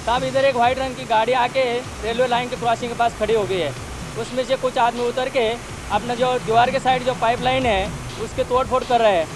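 crackly two-way radio transmission; walkie-talkie radio. Real, not acted. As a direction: speak through a handheld radio. Radio report of suspicious car.

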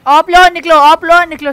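urgent shouted orders. Real, not acted. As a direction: yell the order to get out now. Urgent orders during fight.

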